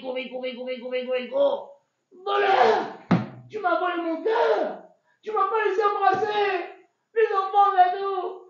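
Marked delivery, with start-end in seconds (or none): 2.2-8.4 furious accusing yell, high and loud; angry shout. Acted. Laban screaming thief at the fleeing Yaakov, enraged. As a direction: scream it like you've been robbed.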